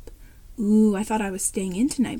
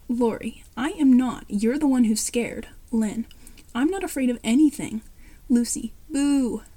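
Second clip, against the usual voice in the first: plain reading voice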